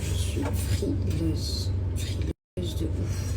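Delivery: slow whispered complaint; whispering. Acted. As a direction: breathe it out slowly. Repeats in whispered voice.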